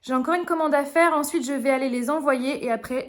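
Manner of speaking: normal talking voice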